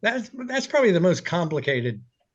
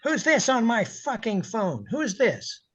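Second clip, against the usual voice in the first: high, gruff angry boss on the phone; mock furious boss voice; Stan Kaplan impression; 7 semitones higher than usual. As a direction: bark like the furious station owner. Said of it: "which I will do now".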